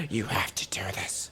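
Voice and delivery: hushed, low dramatic whisper; whispered urging. Retold or imitated. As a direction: whisper it like a movie mentor, hammed-up. Retold scene, urging the hero quietly.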